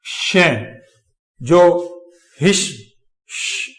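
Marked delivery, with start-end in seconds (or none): 2.4-3.7 हिश, श with a long hushing sh; sh sound demo. Shown as a demonstration. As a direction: draw out the sh in हिश and श as a voiceless hush. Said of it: "श की sound लेके चलता है".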